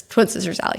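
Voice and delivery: usual speaking voice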